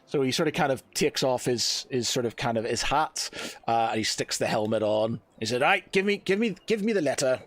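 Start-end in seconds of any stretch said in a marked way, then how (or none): 5.6-7.4 impatient demand. Acted. Impatient captain demands the letter.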